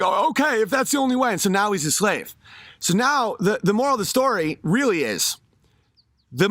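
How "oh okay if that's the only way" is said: higher, timid mock surrender; meek giving in. Stick pig accepting the chain, higher meek surrender. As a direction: give in weakly, pitched up. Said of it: "the pig's like".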